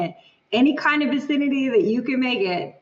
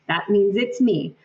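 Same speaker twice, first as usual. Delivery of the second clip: ordinary talk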